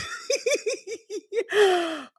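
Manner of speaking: high squeaky giggle; helpless giggling. Real, not acted. Laughing at embarrassment.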